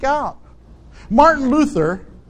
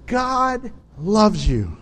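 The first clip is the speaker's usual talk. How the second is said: tender reassurance; much slower than usual. Real, not acted. Pastor comforting a struggling listener, slow and tender. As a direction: let them feel it's true.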